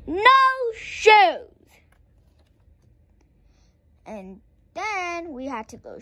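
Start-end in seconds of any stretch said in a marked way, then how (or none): 0.0-1.7 high, loud defiant yell; shrill little-girl shout; child's shout. A narrator's reading. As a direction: shout it like a stubborn toddler.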